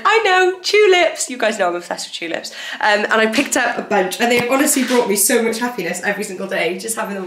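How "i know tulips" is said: 1 excited realization. Retold or imitated. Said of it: "so I was like".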